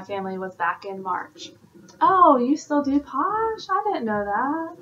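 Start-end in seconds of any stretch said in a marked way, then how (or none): 2.0-4.8 high, slowly drawn-out acted surprise; mock surprise. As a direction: play a surprised acquaintance, pitched up. Voices a surprised acquaintance.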